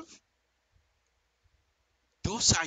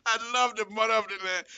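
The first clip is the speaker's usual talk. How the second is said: normal talking voice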